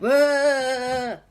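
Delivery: loud, high startled whoa. Acted. Passengers startled by the shaking ground, loud whoa. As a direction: cry out as the train lurches.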